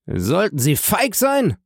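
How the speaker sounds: high, slow scornful question; contemptuous. A narrator's reading. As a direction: sneer the word feig. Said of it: "mit verächtlichem Ton".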